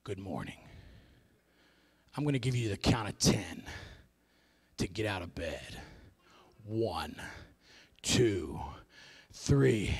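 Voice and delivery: very low and hushed, long pauses; quiet, deep drill countdown; Navy SEAL alarm voice. Acted. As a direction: count slowly in a menacingly quiet military voice. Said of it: "it's literally goes like this".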